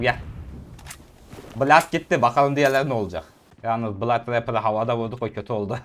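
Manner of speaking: normal talking voice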